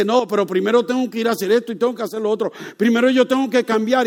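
fast, loud, high voice of a sinner making excuses. Acted. Preacher voicing a churchgoer stalling Jesus, fast and loud. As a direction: rattle off the excuses.

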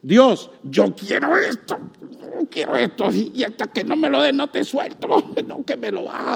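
cling and refuse to let go, hushed; a hint of a demanding child praying; demanding prayer. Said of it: "la oración es algo así".

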